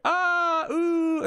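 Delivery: high, held "ah" then "ooh"; imitated eerie noises. Game master mimics illusion noises, high sustained wails. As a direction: sustain two high tones. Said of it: "start hearing noises like".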